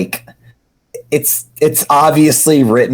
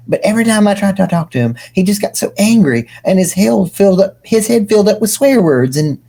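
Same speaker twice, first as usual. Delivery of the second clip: higher, rushing, defensive voice; higher excuse voice; 8 semitones higher, faster than usual. Acted. The prophet defending himself in a higher voice.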